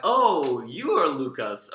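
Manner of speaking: sudden recognition of the angry guy; surprised. Retold or imitated. Realizes Lucas is angry guy.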